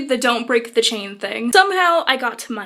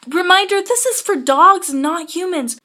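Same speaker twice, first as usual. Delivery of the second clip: mock reminder; 5 semitones higher, 4 dB louder than usual.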